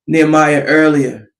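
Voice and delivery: ordinary delivery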